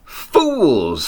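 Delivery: high mock squeal; a short, high scornful squeal. A narrator's reading. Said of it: "squealed number four".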